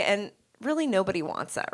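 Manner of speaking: ordinary talk, nothing marked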